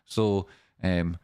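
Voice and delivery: usual speaking voice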